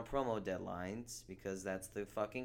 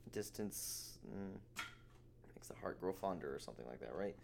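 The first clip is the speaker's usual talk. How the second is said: whispered; 3 semitones lower, 7 dB quieter than usual. Real, not acted. Wistful aside.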